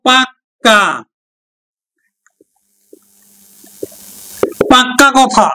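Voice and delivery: পাক্কা with the ক held long and doubled. Shown as a demonstration. Teacher demonstrating পাকা turning into পাক্কা for students. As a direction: hit the double ক in পাক্কা hard. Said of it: "পাকা থেকে যখন আমরা দিব পাক্কা".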